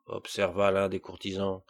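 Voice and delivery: plain reading voice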